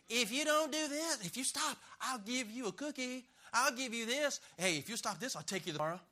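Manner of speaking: hoge, trage smekende ouderstem; bribing parent. Retold or imitated. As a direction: hoog en traag als een omkopende ouder. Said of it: "Bribing is rewarding an evil heart".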